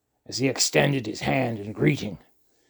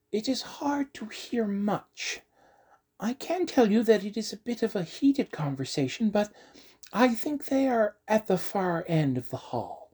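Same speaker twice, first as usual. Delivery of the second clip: hushed little halfling listening; halfling girl voice; 9 semitones higher than usual. Acted. Halfling Virgil listening at door.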